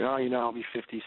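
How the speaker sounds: usual speaking voice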